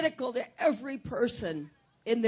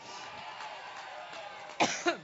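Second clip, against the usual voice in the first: long rough coughing spell; coughing fit; 5 semitones higher, 6 dB quieter than usual. Real, not acted. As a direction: keep coughing, can't stop. Speaker coughing through speech.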